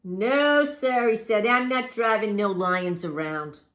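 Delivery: loud, slow refusal; firm cabbie voice; stubborn cab driver. Acted.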